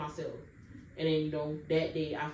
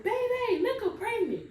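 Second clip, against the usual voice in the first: much higher, sing-song calling voice; mom calling out. Retold or imitated. Mom calling the family about the pregnancy, high sing-song. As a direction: call it up the house like a mother announcing news. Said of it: "So she's like".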